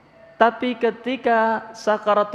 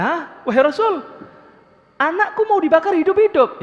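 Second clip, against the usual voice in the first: high, louder shocked gasp of disbelief; shocked mother; 9 semitones higher, 3 dB louder than usual. Acted. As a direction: recoil in horror at the news. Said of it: "Ibunya Alqamah kaget". (ibu kaget, suara tinggi tak percaya)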